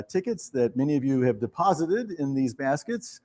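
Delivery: ordinary delivery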